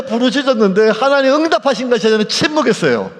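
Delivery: ordinary talk, nothing marked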